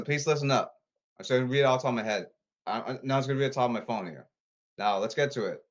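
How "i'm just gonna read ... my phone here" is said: fast, rushed aside; hurried aside. Real, not acted. Vlogger rushing before reading his phone, fast aside. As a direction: rattle it off quickly.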